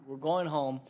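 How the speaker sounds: ordinary delivery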